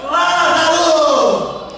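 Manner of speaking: very slow, loud, stretched shout; echoing divine call. Acted. Jesus calling Lazarus in paradise, long shouted call. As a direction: hurl the name out and hold it. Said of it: "ouviu sua voz".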